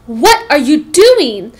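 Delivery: loud, slow scornful demand; angry hiss. A narrator's reading. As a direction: demand it with contempt. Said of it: "she hissed".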